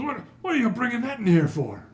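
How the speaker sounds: high, loud protest; indignant dwarf constable. Acted. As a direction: demand an explanation at full voice. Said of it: "He goes".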